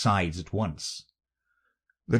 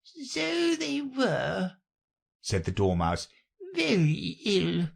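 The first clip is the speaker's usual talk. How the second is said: high, slow little dormouse; 11 semitones higher, slower than usual. A narrator's reading. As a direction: drawl it out like a drowsy mouse.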